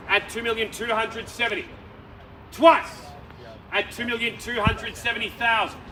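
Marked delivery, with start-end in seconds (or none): 2.5-3.0 high, loud "twice"; loud auction call. Real, not acted. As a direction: ring it out to the room. Going once, twice call.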